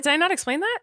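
high, surprised question; genuinely taken aback. Real, not acted. Surprised self-doubt.

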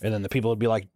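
ordinary talk, nothing marked

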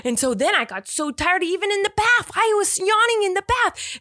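a mother retelling her son's bedtime claim in his voice; imitating little son. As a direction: act out a wide-awake child insisting he's tired. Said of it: "he's like".

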